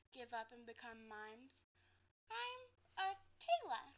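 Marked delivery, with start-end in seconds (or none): 2.3-3.9 higher, shy and hesitant; a nervous girl giving her name. Acted. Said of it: "she was as nervous as I was".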